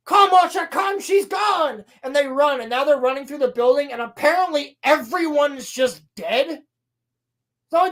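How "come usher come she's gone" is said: urgent call. Retold or imitated.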